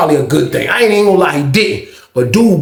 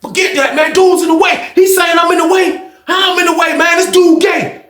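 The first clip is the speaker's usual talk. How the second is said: heated protest; 12 semitones higher than usual. Retold or imitated.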